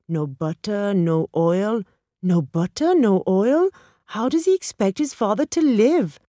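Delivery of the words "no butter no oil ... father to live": higher, louder incredulous echo; appalled disbelief. A narrator's reading. Bhatia outraged at how his friend's son treats him, incredulous. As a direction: question it indignantly, pitch climbing to the end.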